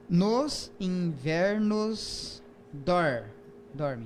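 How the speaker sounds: "Nos invernos dorme" spelled out very slowly syllable by syllable; "Nos invernos dorme" broken into slow counted beats; syllable counting. Shown as a demonstration. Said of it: "vamos contar as sílabas poéticas".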